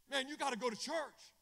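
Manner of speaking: loud, high exhortation; acted urging. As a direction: press a stranger hard to go. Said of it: "I look at them, I said".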